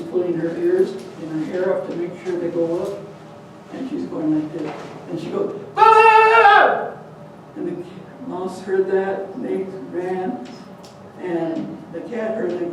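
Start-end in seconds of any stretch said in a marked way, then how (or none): 5.8-6.9 loud, high sung-out bark; mother barking loud and high to scare the cat; loud barking cry. Acted.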